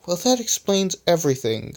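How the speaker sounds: high-pitched dry sarcasm; sarcastic. A narrator's reading. Rubber answers a useless explanation, sarcastic. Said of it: "said Rubber sarcastically".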